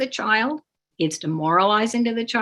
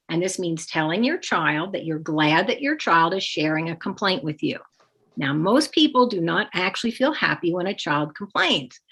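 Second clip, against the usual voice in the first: normal talking voice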